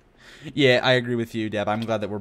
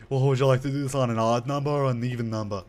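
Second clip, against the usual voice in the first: put-on voice; 3 semitones higher, 4 dB louder than usual. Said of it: "Exactly how I talk, by the way".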